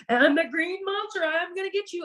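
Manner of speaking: lightly voiced tickle monster chasing the girls; playful monster voice. Husband chasing the girls in the kitchen, mild playful monster voice. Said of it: "he's playing the Tickle Monster".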